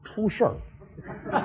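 hushed, drawn-out whisper; secretive muttering. Acted. Gossip confides news to the old man, secretive muttering. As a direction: leak it slowly under the breath. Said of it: "别，别嘀咕".

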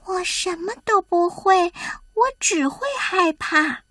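high, small, downcast hedgehog voice; discouraged little creature; dejected. A narrator's reading. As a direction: admit it forlornly, pitched high. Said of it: "亨利沮丧地说".

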